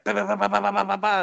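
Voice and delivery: high rapid-fire burst of bahs; imitated machine-gun fire. Toby firing the gun into a berm, imitated gunfire. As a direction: mimic an automatic weapon by voice. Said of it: "shooting this M sixty into this berm".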